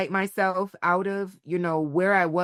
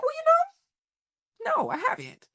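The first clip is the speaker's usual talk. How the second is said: sheepish admission. Retold or imitated. Woman admitting to her inner child she never exploded. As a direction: stretch it out like you've been caught. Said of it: "I just was like".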